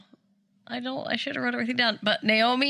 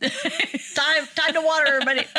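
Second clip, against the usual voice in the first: laughing wake-up call; 6 semitones higher than usual. Acted. Friend mocking wake call.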